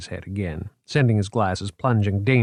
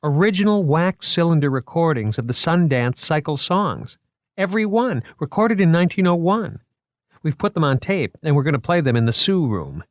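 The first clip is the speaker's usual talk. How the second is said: put-on higher, eager young curator showing off his exhibit; a keen museum man pitching his show, higher and lively; 7 semitones higher than usual. A narrator's reading. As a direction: sell the exhibit with boyish enthusiasm. Moriarty's lines in dialogue.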